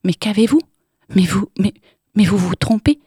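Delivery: panicked stammer to fend her off; stammering in fright. Acted. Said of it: "balbutia Irène".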